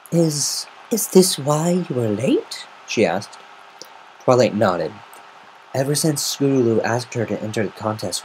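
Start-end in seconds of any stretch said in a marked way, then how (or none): 0.1-2.7 high, slow, stunned lady; Rarity's voice. Acted. Rarity asking Twilight if this is why she was late, slow and high. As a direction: ask it slowly in disbelief.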